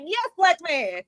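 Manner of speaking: high, lively quoted reply; tossing off a cheeky yes; mock agreement. To a friend, a high mock agreement. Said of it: "I was like".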